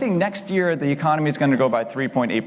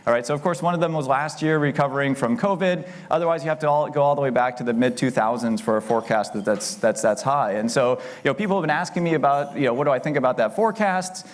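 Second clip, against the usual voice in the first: usual speaking voice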